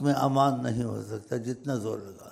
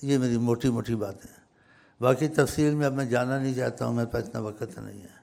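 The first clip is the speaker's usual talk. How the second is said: ordinary talk, nothing marked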